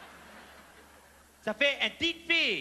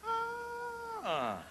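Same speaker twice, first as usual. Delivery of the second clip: mock impressed ah; 11 semitones higher, 8 dB quieter than usual. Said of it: "I went".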